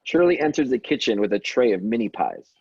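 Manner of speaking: ordinary talk, nothing marked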